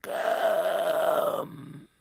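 very high, very slow eerie hiss of a monster; icy hateful voice. Acted. An unseen monster calling Harry to kill him, slow and eerie. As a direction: drag each word out like a hungry creature in the walls. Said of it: "erfüllt von eiskaltem Hass".

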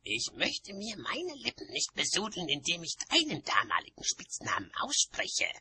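high, disdainful retort; high-pitched witch's protest; calling out. A narrator's reading. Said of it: "rief Oma".

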